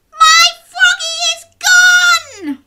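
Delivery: very high, loud wailing witch; witch yell. Acted. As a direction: shriek it in dismay like a witch. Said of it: "yelled a voice in dismay".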